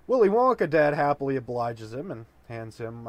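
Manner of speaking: ordinary talk, nothing marked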